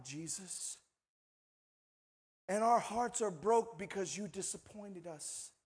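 hushed, low, let-down complaint; disappointed reproach. Retold or imitated. Two grieving friends reproaching Jesus, subdued disappointment. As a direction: quietly blame him, voice dropping away. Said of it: "he's got two of his closest friends saying".

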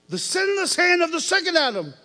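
high, loud proclamation; preaching outburst. Real, not acted. Preacher calling out to the church. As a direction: announce it with force.

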